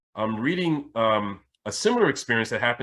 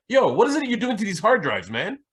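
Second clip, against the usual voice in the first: imitating the IT guy; 11 semitones higher, 3 dB louder than usual. IT guy confronting him.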